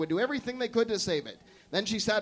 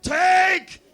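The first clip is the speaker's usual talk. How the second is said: high, loud heroic shout; authoritative scream; 8 semitones higher, 7 dB louder than usual. Acted. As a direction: offer himself with full force. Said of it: "he shouted".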